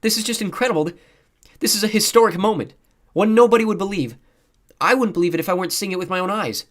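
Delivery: high, loud, thrilled reporting; awed excitement. A narrator's reading. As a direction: proclaim it to viewers, thrilled. Girl reporter marvels at historic moment.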